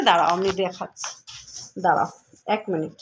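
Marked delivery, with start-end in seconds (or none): none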